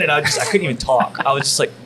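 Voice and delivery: usual speaking voice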